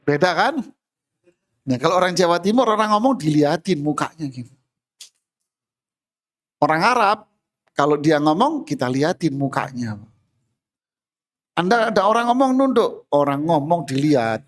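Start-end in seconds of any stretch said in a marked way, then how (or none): none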